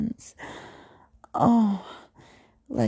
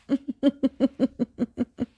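teasing laugh; 5 semitones higher than usual. Acted.

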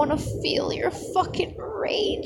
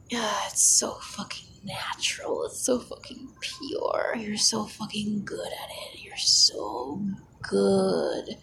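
breathy ASMR whisper, close to the mic; intimate whisper; 5 semitones lower than usual. Acted. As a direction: whisper it like a secret in his ear.

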